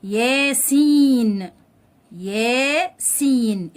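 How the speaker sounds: ياسين very slow, "yaa-seeen"; ياسين in two stretched teaching syllables; drawn-out long vowel. Shown as a demonstration. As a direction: hold the "ee" in ياسين before the n. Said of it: "بيمد الحرف اللي قبليه".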